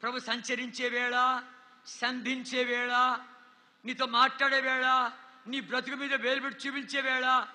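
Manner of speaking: slow, solemn drawn-out phrases; a slow, grave altar call. Acted. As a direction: let each phrase hang gravely. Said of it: "గంభీరమైనటువంటి సమయము".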